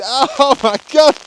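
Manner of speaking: high, loud laughing outcry, slowed; real disbelief breaking into laughter; laughing shock. Real, not acted. Vlogger caught in sudden hail, laughing out loud. Hail hitting his eyes.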